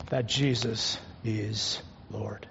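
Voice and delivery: slow whisper, sinking low. Real, not acted. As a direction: breathe the final words to the congregation. Sermon point, whispered close.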